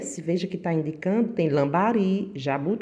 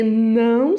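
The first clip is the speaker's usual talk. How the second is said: "não" drawn out long and loud; stress on NÃO; 3 semitones higher, 8 dB louder than usual. Real, not acted. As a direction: lean hard and long on "não". Stressing the key condition of exercise.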